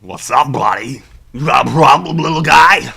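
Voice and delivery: loud, bullying pig-man growl. Acted. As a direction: play a brutish pig behemoth. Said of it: "you gotta do a pig voice".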